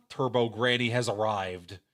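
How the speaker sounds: ordinary talk, nothing marked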